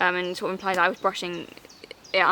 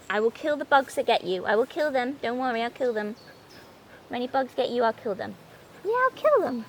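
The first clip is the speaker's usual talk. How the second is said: tender pet talk; 3 semitones higher than usual. Real, not acted. Reassuring her dog about the bugs.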